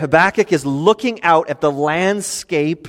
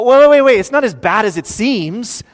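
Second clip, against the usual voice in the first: higher, louder hurried protest, as if God were backpedalling; a hammed-up flustered God pleading not guilty; mock flustered protest; 6 semitones higher, 4 dB louder than usual. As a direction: sputter out a defensive wait wait wait. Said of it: "come back to Habakkuk and go".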